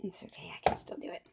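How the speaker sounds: quick whispered aside to herself; whispered self-reassurance. Real, not acted. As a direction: whisper it fast, just for you. Quietly reassuring herself after oops.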